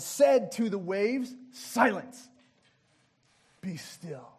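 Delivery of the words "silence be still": commanding words, dropping much lower and softer; calm authority hushing a storm; rebuke. Acted. Jesus rebukes the wind and waves, quiet command. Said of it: "he rebuked the wind".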